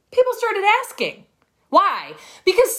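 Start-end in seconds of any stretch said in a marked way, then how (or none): 0.1-1.3 People started asking, pitched much higher and louder; excited echo, much higher; higher, louder repeat. Shown as a demonstration. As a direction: repeat it with a lift of delight.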